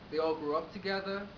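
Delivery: usual speaking voice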